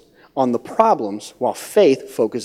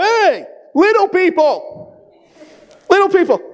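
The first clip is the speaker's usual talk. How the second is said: mock giant; 14 semitones higher than usual. Big guy spotting the tiny spies, high mock call. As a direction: holler it out as a comic giant. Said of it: "one of those big guys would be".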